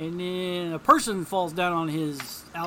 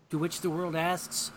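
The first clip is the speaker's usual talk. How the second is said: normal talking voice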